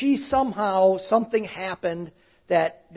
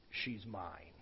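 low, hushed voice of a possessing spirit; demonic voice; 12 semitones lower, 23 dB quieter than usual. Retold or imitated. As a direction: drop the voice way down and let the spirit speak. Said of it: "this voice comes out of this lady".

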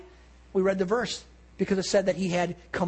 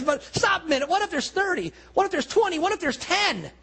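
pleading bargaining; 9 semitones higher than usual. Retold or imitated. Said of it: "he pleads with them".

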